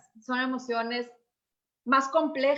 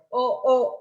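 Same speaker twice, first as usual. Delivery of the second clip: ordinary delivery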